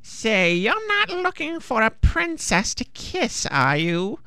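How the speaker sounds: high, creaky witch voice. A narrator's reading. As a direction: play a cackly old witch. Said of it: "asked the witch".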